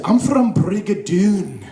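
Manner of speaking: put-on slow, drawn-out Scottish accent; proud fake Scot, Scottish accent. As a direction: lay the Scottish accent on slowly. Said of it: "I pretended I was from Scotland".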